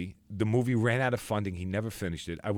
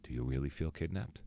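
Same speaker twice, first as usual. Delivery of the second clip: quiet question; 5 semitones lower, 9 dB quieter than usual. Retold or imitated. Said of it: "He looks at me and goes".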